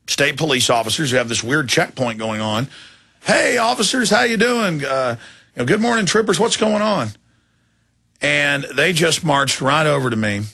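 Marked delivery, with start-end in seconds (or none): 3.2-7.1 pitched way up, sing-song friendly hello; charming greeting. Retold or imitated. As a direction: sweeten it up to disarm the troopers. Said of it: "I put a charming, you know, uh, demeanor on".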